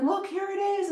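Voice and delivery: high, bright excited call; excited aunts. Retold or imitated. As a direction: call it out high like a joyful aunt. Aunts in dream showing things.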